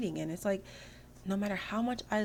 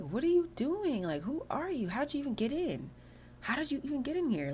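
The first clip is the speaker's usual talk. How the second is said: high, swooping, incredulous questioning; mock indignant; 9 semitones higher than usual. Girl confronts intruder in her house, mock indignant. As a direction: act out an outraged homeowner, pitched way up.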